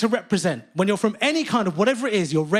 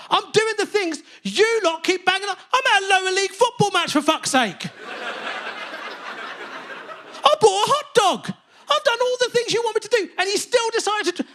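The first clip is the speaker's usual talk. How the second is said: very high, strained outraged rant; high indignant rant escalating; peak indignant rant. Retold or imitated. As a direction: push it to breaking point.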